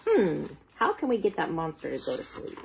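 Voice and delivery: usual speaking voice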